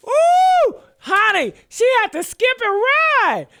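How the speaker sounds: howling glee; gleeful shriek. Real, not acted. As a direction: shriek with delight at the clip. Laughing at her running.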